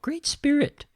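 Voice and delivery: high mock reverent call; quoting Little Bear. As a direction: lift the voice high to the Great Spirit. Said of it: "and I quote".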